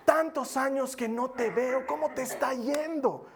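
high, slow, sugary "Carlitos"; mock sweet greeting. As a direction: gush over him as if old friends. La señora finge cariño. (la señora lo saluda con cariño fingido, voz aguda y lenta)

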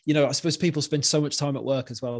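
ordinary delivery

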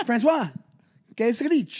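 lightly French-accented mock greeting; French guy impression. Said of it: "the French guy".

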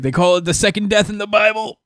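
high, quick mocking preachy voice; mock Daredevil. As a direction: parody a pious hero quoting scripture. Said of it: "He's like".

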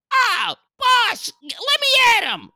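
very high squeaky lackey voice; really high voice. Pretend. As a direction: pitch it way up like an eager henchman. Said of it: "I'd have to go really high".

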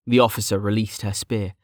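usual speaking voice

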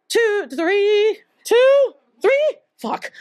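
flustered counting. Real, not acted. Flustered miscounting the men.

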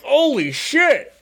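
slow, high amazed exclamation; excited exclamation. Retold or imitated. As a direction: stretch it out high in awe. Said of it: "Tech is just like".

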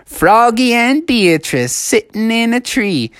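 mocking playground singsong; teasing kids. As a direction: chant it like a schoolyard tease. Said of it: "all the kids were laughing".

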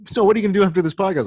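high, fast, mocking question; mocking friend voice. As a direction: mimic the friend teasingly. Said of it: "I'm like".

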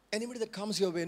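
usual speaking voice

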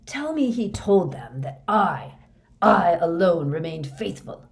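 fanatical fervor. Acted. Moody boasts he alone stayed faithful, fanatical.

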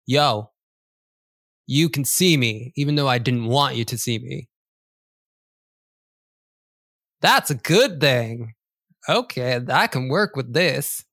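lightly voiced cat picking a fight; rude street cat. Cat keeps talking.

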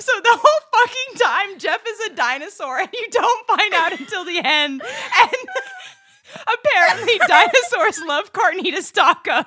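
high, loud hysterical laughter; squealing with laughter through the words; cracking up completely; laughing. Real, not acted.

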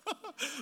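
short real burst of laughter; a quick genuine laugh at the joke. Real, not acted. Real laugh at speed sign joke.

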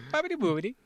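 tossing off "Bobbity boobity" in a sing-song mock accent; mock Italian. Friend teasing about fake Italian, mock accent. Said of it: "did they throw a bobbity boobity".

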